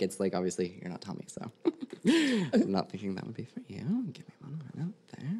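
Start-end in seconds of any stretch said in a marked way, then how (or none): 4.1-5.3 focused whisper. Real, not acted. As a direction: whisper while concentrating. Medium concentrating during reading.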